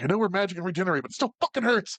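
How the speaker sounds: high-pitched mock whine about the pain; pained elf complaint. Voicing the elf whose pelvis keeps getting crushed, pained high voice.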